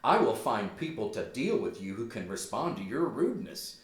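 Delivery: usual speaking voice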